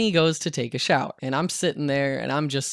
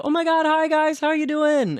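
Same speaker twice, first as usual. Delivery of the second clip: gushing greeting; 3 dB louder than usual. Retold or imitated. Driver greeting two passengers as they get in, gushing. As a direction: imitate an over-the-top friendly greeting.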